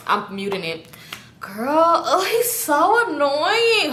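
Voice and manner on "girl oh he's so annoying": slow, high drawn-out complaint; exasperated drawl. Retold or imitated. Complaining to Keisha about the boyfriend, slow and fed up. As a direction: roll it out, fed up. Aside to Keisha while muted.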